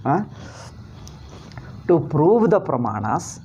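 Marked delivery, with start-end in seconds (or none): none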